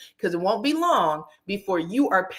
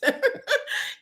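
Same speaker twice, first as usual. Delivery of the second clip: squeaky high unvoiced cackle; high breathy laugh. Real, not acted. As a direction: let out a sudden high airy laugh. Laughs at calling them witches.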